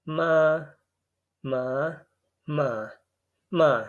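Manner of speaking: Ma said with each of the four Mandarin tones in turn; ma in four contrasting tones. Shown as a demonstration. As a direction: run through the four tones on Ma, one per syllable. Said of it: "We have four tones".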